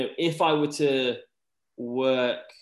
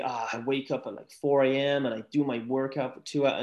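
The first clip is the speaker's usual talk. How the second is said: put-on American accent for quoting motivational types; mock-American motivational speaker voice. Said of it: "chose an American accent to do that".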